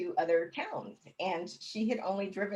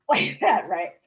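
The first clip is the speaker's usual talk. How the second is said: laughing out loud mid-sentence; words pushed through real laughter; 11 dB louder than usual. Real, not acted. Telling the host her daughter's joke, laughing.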